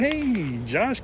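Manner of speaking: a cartoon animal calling sweetly to Josh; Disney cartoon animal. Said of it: "like a Disney movie and start going".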